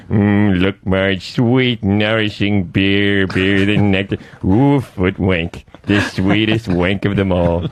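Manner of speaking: low, slow, savouring cartoon voice; Homer Simpson. Comic showing off his Homer on request, low slow drooling impression. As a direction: drawl it out deep and greedy. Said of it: "you want me to do Homer Simpson".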